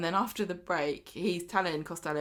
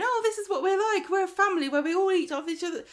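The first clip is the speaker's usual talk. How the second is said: much higher, louder and fast; hammed-up smug family chatter; sneering impression, rushed; mocking the family; 11 semitones higher, 5 dB louder, faster than usual. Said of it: "and going".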